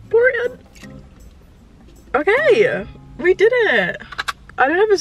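high-pitched excited squeal. Real, not acted. Pouring boba into iced tea.

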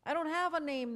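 loud, high screech. Acted. The sick man screeches at Jesus, loud and high. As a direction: screech it out in a wild voice. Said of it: "the man screeched".

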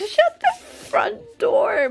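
high, loud, stretched-out disbelief; very high shocked cry; shocked exclamation. Real, not acted. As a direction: squeal it slowly in utter disbelief. Shock at another duplicate.

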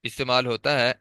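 ordinary talk

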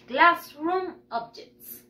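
slow, separated words; repeat-after-me model. Shown as a demonstration. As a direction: say "Classroom objects" slowly for learners. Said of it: "Repeat after me". (modelo lento para repetir)

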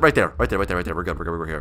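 low, soft self-reassurance; hushed hiding. Real, not acted. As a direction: keep it down while hiding. Hiding from the owner.

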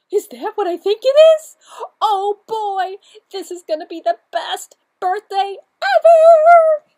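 high, loud overjoyed squeal; thrilled shriek. Acted. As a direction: squeal with delight. Cow's thrilled line.

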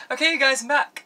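high, loud squealed announcement; excited return. Real, not acted. Vlogger back with viewers after lighting fell, excited squeal. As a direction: burst back in at the top of your voice.

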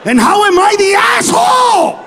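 high, loud protesting yell; hammed-up screech of outrage; indignant shout. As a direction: holler it, hugely wronged.

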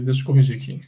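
ordinary talk

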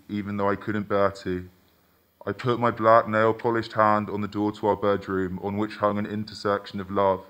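usual speaking voice

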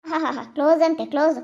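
mock laugh, then scornful echo; scornful mockery. Elder to girl who claims closeness, scornful mockery. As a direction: throw the word back with scorn. Scornfully echoes the girl's word.

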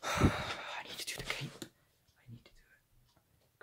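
slow, dreading whisper. Real, not acted. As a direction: whisper it like a confession. Dreading the cape job.

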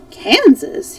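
high, loud, startled echo; surprised exclamation. A narrator's reading. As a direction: blurt it in surprise. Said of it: "he exclaimed, surprised".

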